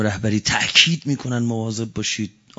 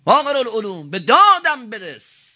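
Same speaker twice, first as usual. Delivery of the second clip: higher, raised cry; cry for help; 11 semitones higher, 5 dB louder than usual. Acted. Preacher voicing truth calling for help, raised cry. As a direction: call out for rescue with some force. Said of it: "حق فریاد زد".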